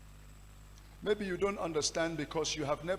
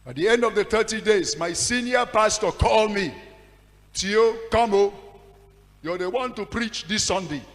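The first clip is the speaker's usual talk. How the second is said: high, loud sermon climax; full-force preaching; fiery preaching; 7 semitones higher, 11 dB louder than usual. Acted. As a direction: build the story to a shout.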